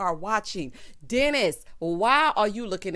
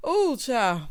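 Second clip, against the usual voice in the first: ordinary talk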